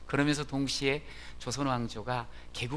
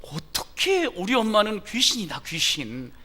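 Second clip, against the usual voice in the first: put-on high, loud startled kid; startled child; 6 semitones higher, 6 dB louder than usual. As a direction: blurt it out caught red-handed. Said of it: "애가 화들짝 놀라가지고".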